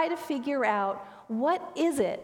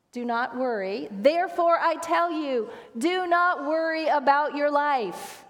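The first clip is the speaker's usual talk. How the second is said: slow, raised, declaimed scripture; scripture reading; 3 semitones higher than usual. Retold or imitated. Pastor echoing Connie starting out the scripture, solemnly declaimed. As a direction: proclaim it slowly, pitched up, drawing out the last phrase. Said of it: "starts out the scripture by saying this".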